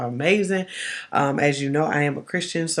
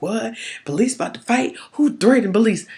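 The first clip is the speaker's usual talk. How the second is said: mock confusion; 6 semitones higher than usual. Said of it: "you're kinda thinking like".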